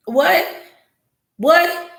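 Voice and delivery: rising, disbelieving what; can't believe what she heard; hearing his excuse, outraged disbelief; incredulous. Real, not acted. Shocked at his claim.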